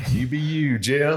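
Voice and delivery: a pushy New York cabbie egging on Jim. Acted. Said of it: "when I get into character".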